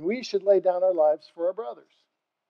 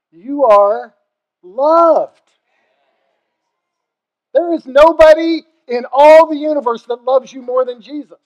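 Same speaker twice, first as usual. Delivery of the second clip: higher and much louder, "are" rising then "loved" ringing out; impassioned shout; 5 semitones higher, 10 dB louder than usual. Shown as a demonstration. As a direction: cry it out to the whole room. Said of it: "I don't think you fathom that".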